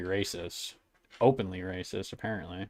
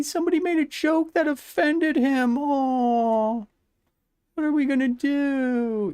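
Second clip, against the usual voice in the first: much higher, sing-song sarcastic sympathy; mock pity; 12 semitones higher than usual. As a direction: coo at him like a crybaby. Mocking Jimmy's victimhood.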